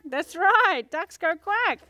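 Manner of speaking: ordinary talk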